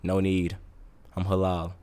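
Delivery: low, soft brush-off; understated, hushed refusal; quiet decline. Retold or imitated. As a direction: turn it down in a low murmur. Acts out declining drink sellers.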